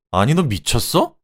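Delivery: husband yelling back in outrage; indignant yell. A narrator's reading. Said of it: "소리쳤죠".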